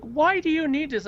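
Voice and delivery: high-pitched exasperated mock demand; imitated upset girlfriend. Girlfriend challenges record collector, high imitated upset. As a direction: act out her exasperation, pitch jumping up. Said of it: "she really got upset when".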